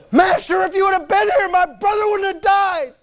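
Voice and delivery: high, strained shout of accusation; anguished, full-voiced angry cry. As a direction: hurl it at him in grief-stricken anger. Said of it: "I believe she was angry".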